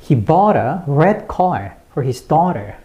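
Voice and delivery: slow, strong "bought", "red car", "daughter"; content-word stress. Shown as a demonstration. Teacher shows learners the sentence, slowly stressing "bought", "red car", "daughter". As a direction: stress "bought", "red car" and first syllable of "daughter", small words light. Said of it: "이렇게 발음을 하시면 되겠습니다".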